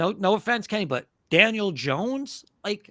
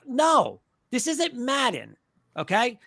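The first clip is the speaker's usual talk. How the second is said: ordinary talk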